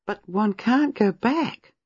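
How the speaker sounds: high, slow, pleading lift on can't; high regretful. A narrator's reading. Gwenda laments to Miss Marple, high and slow. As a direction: raise the voice in regret.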